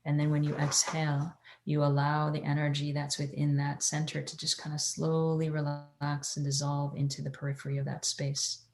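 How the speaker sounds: soft, hushed meditation guide; airy breathy mystical healer. Acted.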